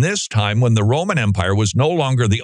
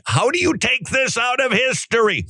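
high, put-on incredulous voice; mock incredulous; 13 semitones higher than usual. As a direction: ask it in a lightly voiced, disbelieving tone. Mock rhetorical question, history teaching.

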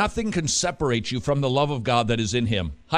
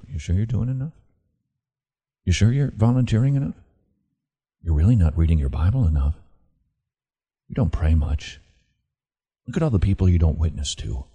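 much lower, needling accuser voice; Satan's taunting voice; 8 semitones lower, faster than usual. Retold or imitated. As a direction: play the devil nagging in your ear. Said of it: "He's the one who's whispering to you".

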